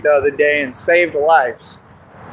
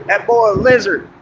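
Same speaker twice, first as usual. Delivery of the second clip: normal talking voice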